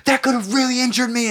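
very high-pitched angry imitation; mock furious dad. Hurt dad blames boy for dropping plywood, mock fury. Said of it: "he was so fucking mad".

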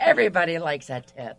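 laughing. Real, not acted. Laughing answer about tips.

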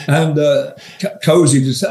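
normal talking voice

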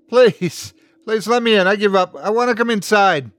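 high, loud begging; desperate pleading. A narrator's reading. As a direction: plead urgently to be let inside. Begs to be let in.

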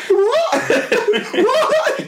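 very high-pitched squeal of disbelief; hammed-up stunned question; mock disbelief. Teacher hearing the glued sheets are flappy, stunned. Acting shock at the flappy books.

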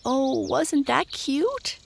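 put-on high, drawn-out girlish delight; delighted cry. A narrator's reading. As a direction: gush slowly in a high voice. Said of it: "cried Mabel".

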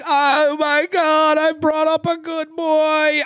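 high, slow, doting parent voice; gushing proud parent. Retold or imitated. Podcaster mocking a contestant's parent, high slow gushing. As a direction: swoon over your son like a smitten mom.

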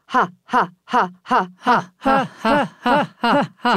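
staccato diaphragm ha ha; laughing sound exercise. Shown as a demonstration. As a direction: pump each ha from the belly.

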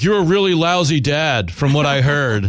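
lightly put-on caller voice; disguised caller voice. Son calling in disguised as George from Queens, light put-on voice. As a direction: fake a stranger's voice to fool your dad. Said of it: "calling in ... in disguise".

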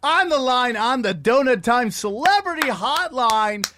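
slow, loud, drawn-out host announcement; welcoming the brother onto the hotline, announcer style; announcer intro. Acted. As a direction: announce him like a talk show host. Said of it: "host introduces caller".

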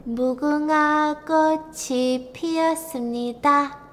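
eerie singsong doll chant; creepy doll voice. Retold or imitated. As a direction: sing it like a haunted doll. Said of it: "the doll turns around and says".

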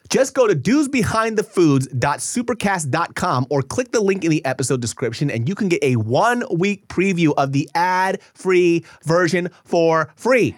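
loud, slow, punchy ad read. Acted. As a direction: spell out the web address slowly.

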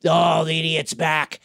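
put-on voice of an exasperated god; Khonshu's voice. Recapper voicing a god scorning his human host, put-on character voice. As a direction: play an otherworldly voice belittling its host. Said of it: "he hears Khonshu's voice say".

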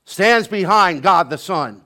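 loud, ringing sermon voice; preaching at full force. Acted. Preacher rousing the congregation, preaching at full force. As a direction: preach it out to the whole church.

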